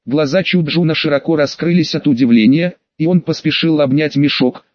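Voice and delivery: usual speaking voice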